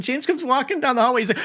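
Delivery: ordinary talk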